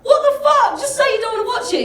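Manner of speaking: rising indignation at the stranger; high-pitched outraged disbelief; getting angry. Retold or imitated. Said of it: "I'm getting angry".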